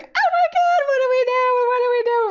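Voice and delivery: very high, very loud frantic squeal; panicked squealing. Retold or imitated. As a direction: reenact a fangirl freak-out. Reenacting panic as phone rings.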